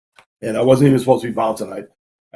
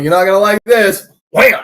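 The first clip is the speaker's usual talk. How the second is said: higher and louder, relishing it; mock threat; 6 semitones higher, 5 dB louder than usual. Bouncer about to hit a troublemaker, gleeful threat. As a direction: tease the guy then land the blow. Retold fight moment.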